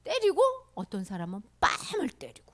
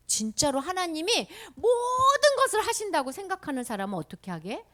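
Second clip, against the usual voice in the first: ordinary talk, nothing marked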